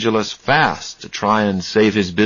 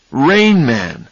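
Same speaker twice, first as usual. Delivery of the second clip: "Rain Man" burst out louder; sudden realization; 5 semitones higher, 4 dB louder than usual. Acted. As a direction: exclaim "Rain Man!" as it dawns on him.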